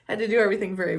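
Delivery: normal talking voice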